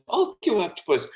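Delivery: put-on high boyish thanks; lightly voiced grateful child; little boy voice. Boy answers octopus at bedtime, lightly grateful.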